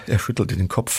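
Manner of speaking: ordinary delivery, nothing marked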